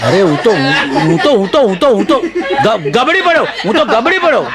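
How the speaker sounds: stammering, pitch climbing as it goes; hammed-up flabbergasted sputter; flustered shock. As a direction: stutter repeatedly in mock shock. Acts shock at whispered invitation.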